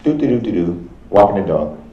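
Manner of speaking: sing-song imitation of someone oblivious; mock nonchalant. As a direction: toss it off like an idle stroller. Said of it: "So basically it was just like".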